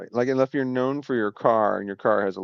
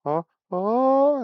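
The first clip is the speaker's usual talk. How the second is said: rising gasps; 13 semitones higher than usual. Retold or imitated. Onlookers gasp as the ash teeters, rising alarm. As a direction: pitch climbs high on the second oh.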